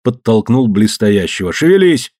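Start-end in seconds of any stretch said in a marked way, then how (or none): 1.5-2.1 shouted order. Acted. Owner shoving a worker.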